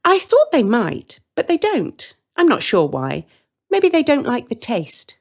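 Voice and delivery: a sweet, carefree baker explaining; the woman's voice. Acted.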